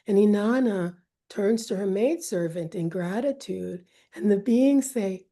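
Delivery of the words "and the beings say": ordinary delivery, nothing marked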